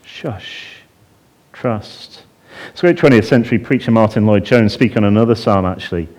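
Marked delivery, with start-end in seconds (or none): 0.0-2.2 soft whispered shush to the heart; hushing whisper. Pretend. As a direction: whisper it like calming yourself. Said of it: "that's what he says to his heart".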